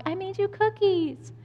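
high, sugary neighbor voice; sweet neighbor. Retold or imitated. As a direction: imitate a perfect neighbor bearing treats. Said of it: "the neighbor that comes over".

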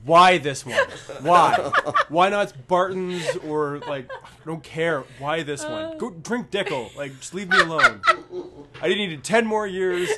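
hammed-up old drinker's grievance; exasperated old man. Old man complaining that his cheap whiskey got popular, hammed-up exasperation. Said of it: "I feel so bad for the old man".

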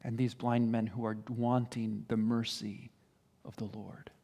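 low, soft, fading to a whisper; hushed. Real, not acted. Preacher on blind men wanting mercy, hushed. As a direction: drop right down, almost whispering by the end. Preacher drops voice after shouted rebuke.

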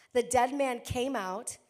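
ordinary talk

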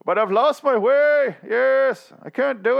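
high, slow, put-on despairing king; mopey king voice. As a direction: whine it like a defeated monarch. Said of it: "He's like".

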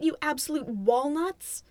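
ordinary talk, nothing marked

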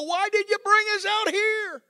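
yelling. Retold or imitated. Preacher voicing the Israelites yelling at Moses, loud. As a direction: yell the Israelites' grievance. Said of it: "apologize for yelling".

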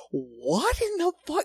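high, incredulous mock reaction; stunned disbelief. Hearing the scammer's threat, asking in high disbelief. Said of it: "I was like".

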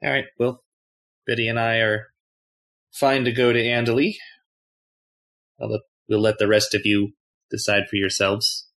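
normal talking voice

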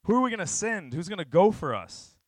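booming call for a volunteer, high and loud; loud, high, summoning cry; God. Acted. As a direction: call out the question at full voice. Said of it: "I heard the voice of God saying".

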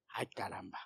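breathy whispered sigh of dismay; whispered aside. Real, not acted. Pastor whispering to himself after the lice story, an aside. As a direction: whisper it under your breath like a shy confession.

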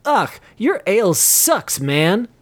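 slow whiny complaint about the ale; disgusted. A narrator's reading. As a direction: groan it out after spitting. Spits out gravel.

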